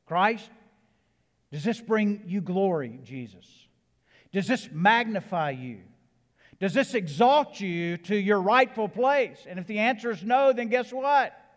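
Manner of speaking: loud, high-pitched appeal to Christ; impassioned preaching. Acted. Preacher models a question to Christ for the congregation, impassioned and rising. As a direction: preach it out, building in pitch and volume.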